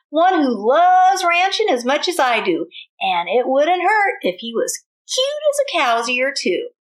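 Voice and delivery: Miss Lurleen. Acted. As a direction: sing it out like a hopeful Texas belle. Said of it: "she said".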